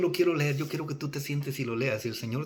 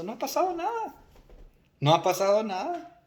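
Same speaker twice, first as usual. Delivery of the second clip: lilting, higher-pitched unworried reassurance; unbothered reassurance; 6 semitones higher than usual. Acted. The Lord answering a worried church from heaven, unbothered. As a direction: brush the worry off, pitch lifting.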